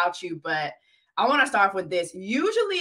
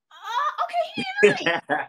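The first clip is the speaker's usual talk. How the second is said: mock realization. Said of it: "I was like".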